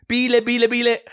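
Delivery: höher und lauter, Enten lockend; lockend rufen. Retold or imitated. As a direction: wie ein Mann, der Enten ruft. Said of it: "dann sagt er". (Mann ruft den Enten laut lockend zu)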